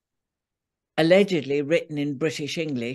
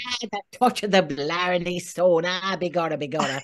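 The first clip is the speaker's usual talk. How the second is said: lightly hammed stage Irish accent; a mock Blarney Irish accent. Playing the Lucky Charms guy in a stage Irish accent. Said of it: "That kind of Irish".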